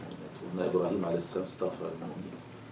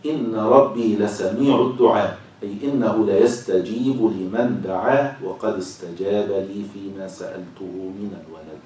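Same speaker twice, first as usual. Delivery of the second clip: slow, loud, drawn-out scriptural reading; formal reading aloud; 8 dB louder, slower than usual. Acted. Teacher reads verse and commentary to class, slow formal reading. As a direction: read it out with stretched, emphatic phrases.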